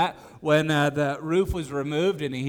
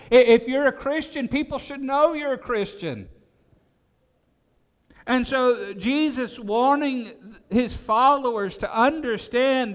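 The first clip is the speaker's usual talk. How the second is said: raised, higher preaching voice, pressing each point; preaching at full force; 6 semitones higher, 4 dB louder than usual. Acted. Preacher urging the congregation that faith must show, fervent preaching.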